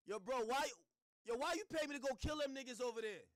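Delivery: loud, high accusing phone voice; imitating a caller sounding like a fed; mock suspicious caller. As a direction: fish for a confession on the call. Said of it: "that sound mad federal".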